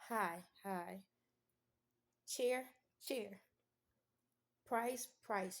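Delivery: clipped single words with pauses; curt one-word delivery. Shown as a demonstration. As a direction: bark each word once and stop. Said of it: "you got to be so direct. It's like one word".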